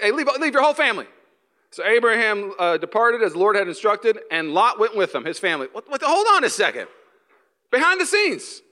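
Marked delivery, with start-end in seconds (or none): 0.0-1.1 higher, fast urgent order; voice of God commanding. Acted. The Lord telling Abram to leave, fast high command. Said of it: "Let's go back". 5.7-8.6 much higher laughing stop; mock surprise. Preacher halting Abraham's story, high laughing surprise.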